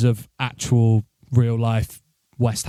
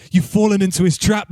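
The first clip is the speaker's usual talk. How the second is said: ordinary talk, nothing marked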